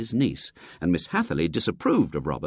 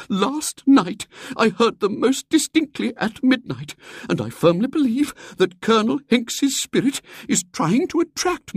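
put-on much higher, louder, dramatic old lady; Miss Hatherley; 7 dB louder than usual. Miss Hatherley telling the society of the haunting, dramatic. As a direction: build the ghostly suspense.

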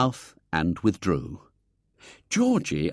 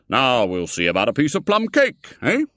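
put-on hearty old gentleman; 4 semitones higher, 5 dB louder than usual. A narrator's reading. Foulard offering cake.

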